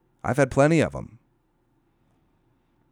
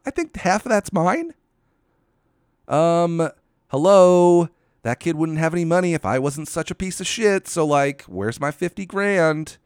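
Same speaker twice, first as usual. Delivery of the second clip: mock Amber voice; 7 semitones higher, 3 dB louder than usual. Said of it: "and she's like".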